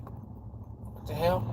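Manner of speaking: a slow, breathless whispered quote of the stunned man; imitating his hushed disbelief, slowly; mock whisper. Retold stunned reaction of debtor.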